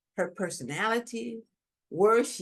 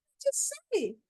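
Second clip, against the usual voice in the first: shocked inner voice. Retold or imitated. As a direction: pitch jumps up, stunned at what she just said. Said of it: "in my head, I'm like".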